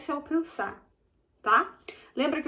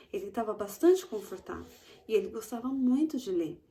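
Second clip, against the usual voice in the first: ordinary talk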